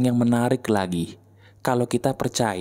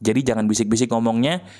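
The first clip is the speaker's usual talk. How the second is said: ordinary delivery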